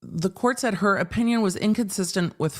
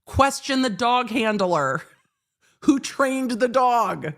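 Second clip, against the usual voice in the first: lecturing retort; 6 semitones higher than usual. Retold or imitated. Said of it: "I'm like".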